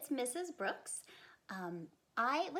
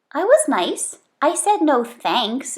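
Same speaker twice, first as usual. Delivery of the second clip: put-on higher, louder indignant kid voice; Bean protesting; 4 semitones higher, 21 dB louder than usual. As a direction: insist like a kid defending herself. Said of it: "said Bean".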